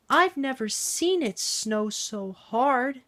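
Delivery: high, slow small-engine voice; wide-eyed wonder at the snow; Percy's voice. Acted. Percy marvelling at the snow to friends, high and slow.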